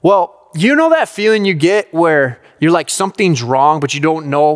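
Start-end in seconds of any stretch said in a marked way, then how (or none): none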